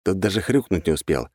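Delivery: ordinary delivery, nothing marked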